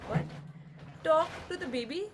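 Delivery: incredulous rise on talk; mock disbelief at the advice; surprised. Mother hearing she should talk to the baby, incredulous. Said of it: "And I was like".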